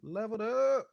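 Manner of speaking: high, loud burst of the song title; sudden high excited exclaim; hyped title callout. Real, not acted. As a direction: shout the title out with pride. Artist exclaims own song title.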